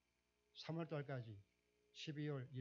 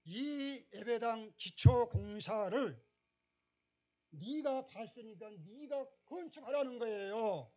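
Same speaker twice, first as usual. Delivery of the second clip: much higher, much louder, slow preaching; impassioned preaching; 9 semitones higher, 12 dB louder, slower than usual. Acted. Pastor pressing God's charge on the congregation, booming. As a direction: drive every phrase home with full force.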